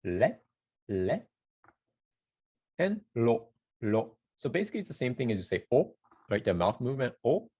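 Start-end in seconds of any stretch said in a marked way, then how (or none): 0.0-4.1 "le" and "lo" with a flick of the tongue tip; Japanese r sounds. Shown as a demonstration. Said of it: "combine that with the tongue".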